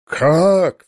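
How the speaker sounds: sharp, high, loud «Как?»; startled cry. A narrator's reading. The prince, caught off guard by the narrator's words, startled cry. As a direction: blurt it out, thrown off balance. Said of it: "вскричал он".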